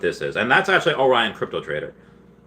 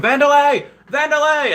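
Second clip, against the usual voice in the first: high, loud frantic yell; shrill panicked George impression; 6 dB louder than usual. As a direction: imitate George bursting out shouting. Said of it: "George coming out of the bathroom".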